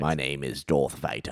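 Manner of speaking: put-on low, prim English accent; Darth Vader. Trying friend's suggested English accent, deep and proper. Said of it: "like, a prim and proper, like, English accent".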